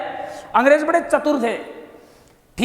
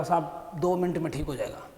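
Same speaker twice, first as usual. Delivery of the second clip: softer, lower doctor calming a patient; soothing doctor; 6 semitones lower, 12 dB quieter than usual. Retold or imitated. Doctor reassuring the emperor before lancing, soft and low. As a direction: hush him along gently before the cut.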